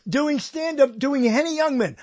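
high, slow stretched-out sales pitch; hammed-up enthusiasm, well above normal pitch; excited pitch. Pitching the bit in raised voice.

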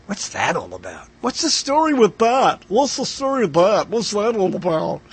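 a lightly done Seinfeld bit, bad imitation; Jerry Seinfeld impression. Host doing a bad Seinfeld while musing on phrases. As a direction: ask it like a sitcom comedian, half-hearted. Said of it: "that's a bad imitation right there".

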